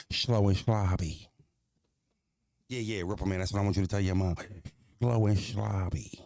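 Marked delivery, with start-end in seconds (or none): 0.1-1.3 drawn out slow and loud, slurring the sh; slow and sloppy. Shown as a demonstration. Streamer dictating a diss to a viewer, drawn out slow and loud. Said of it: "But I would say it like that, though". 5.0-6.2 drawn out slow and loud, slurring the sh; slow and sloppy. Shown as a demonstration. Streamer dictating a diss to a viewer, drawn out slow and loud. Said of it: "But I would say it like that".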